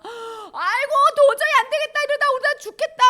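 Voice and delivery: high, loud lament; panicked disciples. Acted. Disciples fearing they will all die, high acted panic. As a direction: wail it out in mock desperation. Acts disciples despairing in storm.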